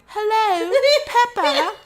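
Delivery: very high, loud cartoon British call; Susie Sheep, British accent. Friend left on a dead line, calling in high mock-British accent. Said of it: "Susie is on the other end going".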